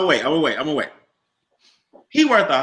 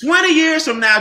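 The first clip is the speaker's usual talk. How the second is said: excited call out; 9 semitones higher, 6 dB louder than usual. Real, not acted. Rallying the room.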